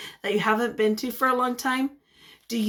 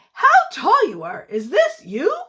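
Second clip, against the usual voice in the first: amazed relative; 4 semitones higher, 5 dB louder than usual. Acted. Relatives greeting the children.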